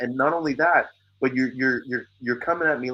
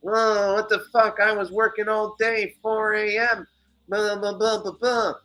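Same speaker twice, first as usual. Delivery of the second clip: angry neighbor; 10 semitones higher than usual. Retold or imitated. Neighbor complaining through the wall again, mocked high and whiny. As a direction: pitch it way up like an outraged whiner. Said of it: "I hear his voice again".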